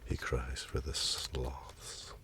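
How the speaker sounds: telling a sad comic scene to viewers, in a mock-tragic hushed voice. Mock-solemn narration dying away to near silence.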